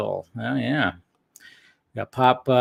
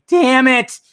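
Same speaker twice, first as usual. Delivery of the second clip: high, loud frustrated outburst; imitating frustration; 11 semitones higher, 6 dB louder than usual. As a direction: relive the groan at the strikeout. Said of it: "and I was like".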